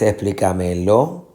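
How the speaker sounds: explícamelo with LO stressed and rising; stress on last syllable. Shown as a demonstration. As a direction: wrongly push the weight of explícamelo onto LO. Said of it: "la fuerza de pronunciación, no acá". (explícamelo con acento final en LO)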